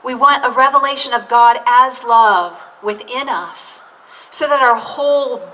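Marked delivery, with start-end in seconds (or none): none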